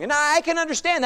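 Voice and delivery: ordinary talk, nothing marked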